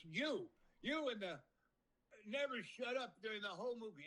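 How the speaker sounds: lightly acted movie scold, singling someone out; mock Scrooge rant. Scrooge on screen scolding the chatty moviegoer, mock high pointed call-out. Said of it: "And he goes".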